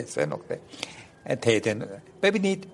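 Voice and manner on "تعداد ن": usual speaking voice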